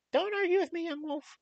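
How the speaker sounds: high, loud stern mother shout; mom scolding. Retold or imitated. Mother shouting down her arguing son. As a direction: shut the argument down with a yell.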